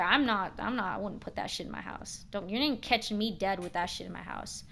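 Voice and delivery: ordinary talk, nothing marked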